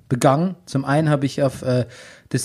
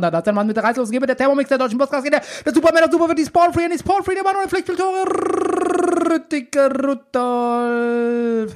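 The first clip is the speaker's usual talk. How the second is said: hype announcer build; 9 semitones higher, faster than usual. Acted. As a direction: pitch climbing higher and higher. Hype intro building to name.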